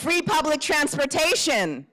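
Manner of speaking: rally the room with a prompt; calling out. Shown as a demonstration. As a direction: call it out high to the crowd. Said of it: "calling prompts to the crowd".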